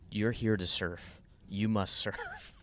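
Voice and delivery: slow, drawn-out order to self; stretched-out mock decree; mock self-command. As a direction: lay down the law slowly, with a laugh breaking in. Commanding himself to paddle out.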